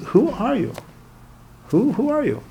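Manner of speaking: high-pitched, wondering retold question; astonished question. Rabbi questions his mysterious pupil, astonished. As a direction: ask it high, in mild amazement.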